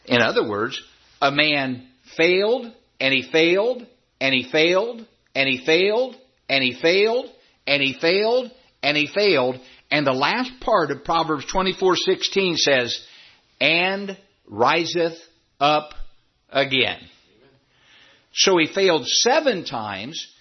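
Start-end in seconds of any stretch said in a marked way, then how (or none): 1.2-9.6 "failed" lifted each time, falling on the last; measured chant-like repeats, "failed" rising until the final fall; repetitive list. Shown as a demonstration. Preacher hammering home repeated failures, rising "failed" each time. 13.6-17.0 "and" drawn out long before "riseth up again"; stress on AND. Shown as a demonstration. Preacher quoting Proverbs, long stressed "and". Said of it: "That word "and," it says".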